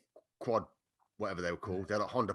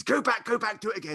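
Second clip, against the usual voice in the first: mock instructor call; 10 semitones higher than usual. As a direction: call them back like a fussing coach. Instructor calling them back.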